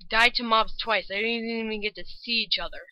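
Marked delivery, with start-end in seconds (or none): none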